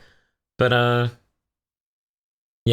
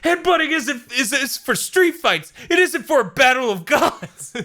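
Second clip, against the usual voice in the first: mock yell; 12 semitones higher than usual. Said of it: "Beers yells at him".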